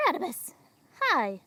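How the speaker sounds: bright, loud hello to a newcomer; high happy shout of welcome; excited greeting. Real, not acted. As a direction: call out the name with delight. Greeting a new viewer excitedly.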